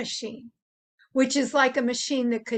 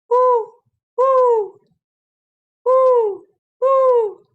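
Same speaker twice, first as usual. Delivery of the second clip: high, loud hooting woo; owl hoot; 12 semitones higher, 11 dB louder, much slower than usual. Shown as a demonstration. Showing the kids an owl's loud high hoot. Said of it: "like an owl".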